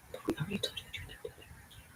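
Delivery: hushed, breathy whisper. Real, not acted. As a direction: lean in and whisper it.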